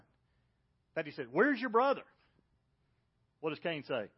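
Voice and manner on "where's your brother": high, loud call for the missing brother; God calling out. Acted. God calling for Abel to Cain, a loud high call. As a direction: call out Where's your brother? like searching.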